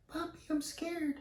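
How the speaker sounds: high falsetto imitation of a frightened girl; put-on scared girl. Girl phoning her pimp in fear, high put-on voice. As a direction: squeak it softly as a scared girl. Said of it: "let me do my regular voice".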